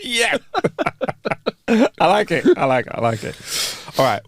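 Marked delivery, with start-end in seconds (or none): 0.4-1.6 high breathy giggle; wheezy high laugh; high voiceless giggle. Real, not acted. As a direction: giggle at the dad joke. Laughs at dad joke.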